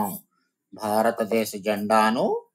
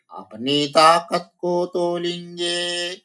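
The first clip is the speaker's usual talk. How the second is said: raised, sing-song recital of the line; poem recitation; 7 semitones higher than usual. Shown as a demonstration. Teacher reciting the poem line to her students, sing-song. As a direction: chant the verse to the class.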